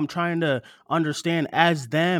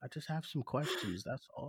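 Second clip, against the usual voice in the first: very soft, lowered retreat; meek; 4 semitones lower, 14 dB quieter than usual. Real, not acted. Host excuses grilling his co-host, meekly. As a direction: say it small, as if backing off.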